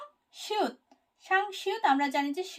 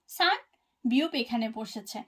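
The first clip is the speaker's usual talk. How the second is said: ordinary talk, nothing marked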